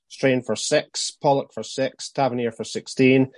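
usual speaking voice

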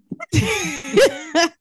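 a burst of bright laughter; loud laugh. Real, not acted. Reacting to the man joke.

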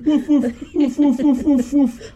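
high yappy mock woofs; laughing, high-pitched pretend barks; imitated dog barking. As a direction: play the boy pretending to be a puppy. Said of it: "running around the coffee table ... going".

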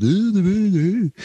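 high British "ooh". Shown as a demonstration. Showing second British voice, high Beatle oohs. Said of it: "like a beetle that's like".

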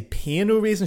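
pain said like English "pain", raisin as English "raisin"; anglicized French. Retold or imitated. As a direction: pain au raisin read as plain English words. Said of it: "Do I say".

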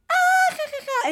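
very high mock laughter imitating the host; imitated laugh. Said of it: "se empezó a reír". (imitando la risa de Ashley, muy aguda)

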